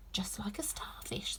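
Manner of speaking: slow hushed whisper to herself; a quiet private aside; whispered thought. A narrator's reading. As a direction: murmur the thought under your breath.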